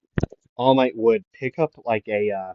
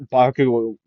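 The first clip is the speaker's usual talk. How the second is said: ordinary delivery, nothing marked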